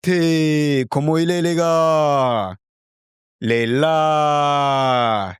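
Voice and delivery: put-on high, lilting Reunion Creole accent; mimicking Reunion Creole, slow at the end; Reunion Creole imitation. Learner mimicking Reunion Island Creole accent. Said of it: "trying to imitate their Creole".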